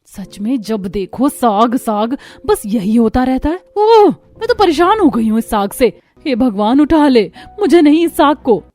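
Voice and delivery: loud, exasperated ranting; angry outburst. Acted. Younger daughter-in-law rants to her sister-in-law, loud and exasperated. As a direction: let the frustration spill out loudly. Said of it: "दोनों बहुत गुस्सा हो रही थी".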